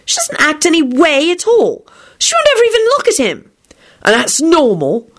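arguing couple. Acted. Argument continues.

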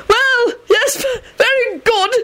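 high, flustered nervous voice; terrified babble. A narrator's reading. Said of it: "he babbled".

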